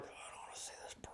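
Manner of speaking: fast, grumbling whisper. Retold or imitated. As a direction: mutter it like a sleepy grandpa who's had enough. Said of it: "And I'm like".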